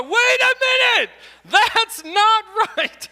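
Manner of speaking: high, loud, slow cry of alarm; hammed-up shout of alarm; mock alarmed worker. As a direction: play the worker who suddenly spots the mistake. Imagined worker noticing error.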